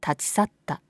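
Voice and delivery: ordinary delivery, nothing marked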